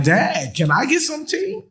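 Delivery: high, sing-song ask for tea; childish plea. Pretend. Speaker playfully begs Dad for tea in a high childish voice. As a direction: beg like a kid asking Dad.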